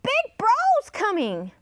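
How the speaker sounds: high-pitched parrot warning; high storybook parrot call; squawking parrot. A narrator's reading. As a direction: sound the alarm as a high parrot. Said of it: "said the parrot".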